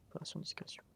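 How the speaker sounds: full unvoiced stealth whisper. Real, not acted. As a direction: breathe it out without voice.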